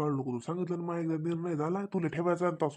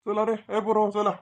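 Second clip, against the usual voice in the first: put-on loud, higher summons to the children; raised, hurrying shout; calling out; 4 semitones higher, 7 dB louder than usual. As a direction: call the kids to get moving.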